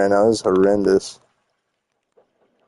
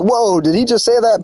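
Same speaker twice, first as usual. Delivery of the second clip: mock shocked reaction; 10 semitones higher than usual. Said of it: "say something where it's like".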